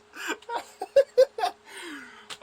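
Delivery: high, loud laughing fit; real uncontrollable laugh; helpless laughter. Real, not acted. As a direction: lose it completely. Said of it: "I can't contain myself".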